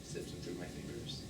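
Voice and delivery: almost to himself, drawn into the memory; hushed confession. Acted. Confessing how he holds the ashes.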